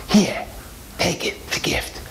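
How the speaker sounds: hushed, creepy offering; secretive old woman's whisper; conspiratorial whisper. Acted. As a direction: drop to a soft whisper as you press it on her. Sinister neighbour presses necklace on Maya.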